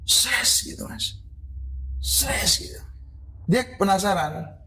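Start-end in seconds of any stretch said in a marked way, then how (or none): 0.0-0.5 voiceless hissing "sses"; hissed apparition sound effect; imitated swish. 2.0-2.5 voiceless hissing "sses", softer; hushed hissing sound effect; imitated swish.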